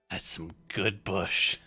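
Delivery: slow, lazy stoner voice; stoned drawl. Acted. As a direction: savor it like a happy stoner. NPC savors his smoke.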